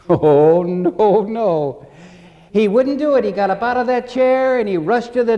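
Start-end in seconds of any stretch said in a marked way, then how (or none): 0.1-1.9 loud wail of protest, pitched up; hammed-up dread; mock alarmed refusal. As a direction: play a scared patient refusing for laughs. Patient refuses the needle again.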